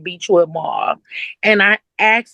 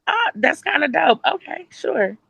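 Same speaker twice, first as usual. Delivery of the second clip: light, higher-pitched casual approval; pitched up and offhand; casual agreement; 7 semitones higher than usual. Retold or imitated. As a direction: act out an easygoing yes. Retold own pleased reply.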